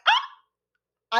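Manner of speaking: short high gasp of shock; stunned gasp. Retold or imitated. After slapping aunt back, a high shocked gasp. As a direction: gasp like you just saw a slap land.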